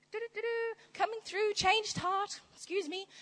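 high, sing-song strut; mock show-off. Preacher mocking a boastful believer, high sing-song. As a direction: prance through humming your own praise.

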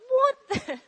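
high, loud, laughing disbelief; mock bafflement. Teacher retelling her reaction to the kids' game, mock baffled. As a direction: blurt it out high, amused and puzzled. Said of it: "And I was like".

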